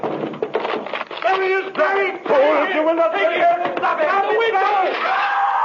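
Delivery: shrieking high panic; frantic screaming. Acted. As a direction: scream while falling. Villain falls from window screaming.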